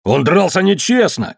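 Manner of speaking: high-pitched accusing cry; shouted accusation. A narrator's reading. Tombol answers Mary Lee's defence, high accusing cry. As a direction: protest in a high voice. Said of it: "крикнул Томбол".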